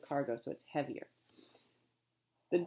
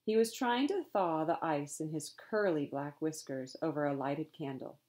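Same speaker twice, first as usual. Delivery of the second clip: plain reading voice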